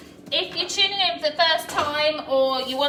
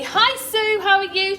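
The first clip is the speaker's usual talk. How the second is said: much higher, louder cheery hello; bright greeting; 8 semitones higher, 6 dB louder than usual. Real, not acted. Welcoming a viewer in the live comments, a high cheery greeting. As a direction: greet her like a friend walking in.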